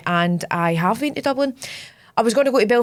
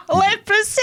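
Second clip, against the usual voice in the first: laughing; 10 semitones higher than usual. Real, not acted. Joking, laughing.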